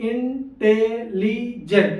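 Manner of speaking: four slow, separated beats on intelligent; syllable by syllable. Shown as a demonstration. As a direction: break intelligent into in-te-li-jent with gaps. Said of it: "चार बार पे बोला ना".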